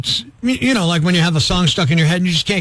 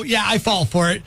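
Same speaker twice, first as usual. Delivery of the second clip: normal talking voice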